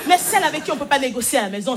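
ordinary talk

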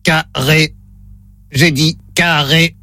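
slow, low, mechanical robot voice. Pretend. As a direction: play the robot reading test words. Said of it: "un robot va vous dire le mot cercle". (voix de robot lente et grave)

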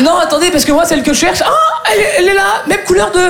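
high, hurried-sounding mock panic; flustered pleading. Chaser explaining himself to metro agent, high and flustered. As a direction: blurt an excuse, then spot her excitedly.

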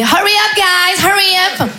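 high, drawn-out mock call to the family; calling out in English. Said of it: "elle faisait". (appel haut perché en anglais)

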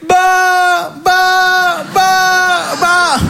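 high, long-held "bah" syllables; big "bah". Retold or imitated. Patient doing the speech therapist's big syllable drill, drawn-out high "bah". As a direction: hold each "bah" high and steady. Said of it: "Maintenant, les grands, bah".

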